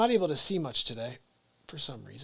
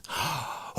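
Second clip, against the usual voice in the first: sharp gasp; 7 dB quieter than usual. Retold or imitated. Reacting to grandma's revelation.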